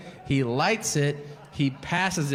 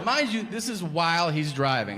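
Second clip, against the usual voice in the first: ordinary talk, nothing marked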